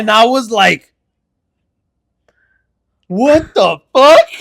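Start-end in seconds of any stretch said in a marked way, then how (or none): 3.1-4.3 high, loud, slow disbelief; stunned disbelief. Retold or imitated. Watching the hamster fall from the sky, high loud slow disbelief. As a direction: drag it out in shock. Retold shock hamster flew.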